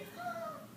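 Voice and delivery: sudden frightened gasp in; sharp gasp. Acted. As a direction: gasp as if shocked underwater. Terrified by shark attack.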